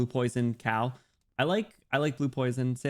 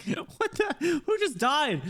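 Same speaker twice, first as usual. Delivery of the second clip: much higher, laughing startled cry; startled yelp; 14 semitones higher, much slower than usual. Retold or imitated. Streamer retelling her reaction to a sound alert, high laughing surprise. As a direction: squeak it out in surprise. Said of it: "Dude, I was like".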